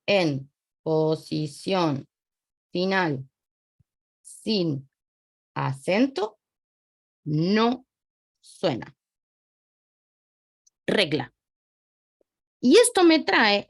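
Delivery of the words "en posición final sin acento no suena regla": word by word, long pauses between; slow dictation. Real, not acted. Teacher writing out a rule for students, slow dictation. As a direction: spell out the rule slowly, piece by piece.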